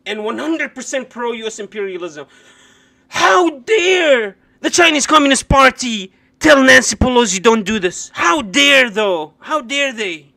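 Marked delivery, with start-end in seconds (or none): 3.1-10.3 mock indignation. Streamer mocking outrage over China warning Pelosi, loud and sarcastic. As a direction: ham up the outrage.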